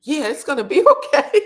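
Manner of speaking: mock comfort, cracking up mid-line; retelling a line while laughing; laughing through the quote. Mock reassuring quote breaks into laughter.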